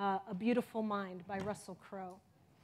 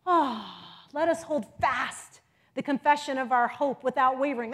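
usual speaking voice

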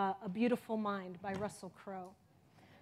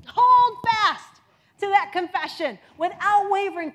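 loud exhortation; 8 semitones higher, 14 dB louder than usual. Acted. Urging the congregation.

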